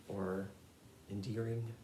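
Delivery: ordinary talk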